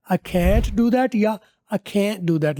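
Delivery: slow, "can't" drawn out; "I can't do that" with a stretched vowel in can't; long can't. Shown as a demonstration. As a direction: lengthen "can't". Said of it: "लंबा करके इसको बोला जाएगा".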